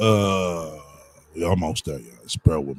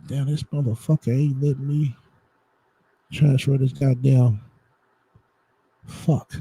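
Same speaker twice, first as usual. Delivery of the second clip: frustrated outburst; 9 semitones higher, 4 dB louder than usual. Real, not acted. Computer won't let him transfer.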